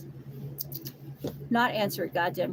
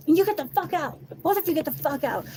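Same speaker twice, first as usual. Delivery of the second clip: higher, heated mock yell; mock yell at both women; retold yelling; 9 semitones higher, 4 dB louder than usual. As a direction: order them out, pitched up. He throws them out.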